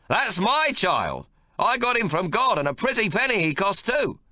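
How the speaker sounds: put-on high, loud, bossy old grandfather; gruff domineering old man. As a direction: bark orders at the new mother. Old man voiced in story.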